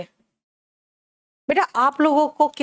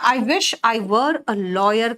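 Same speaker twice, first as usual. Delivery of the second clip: slow, spelled-out teaching read; slow model sentence; much slower than usual. Shown as a demonstration. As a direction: lay it out slowly for students. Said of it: "है ना?". (धीमा, समझाता हुआ उच्चारण)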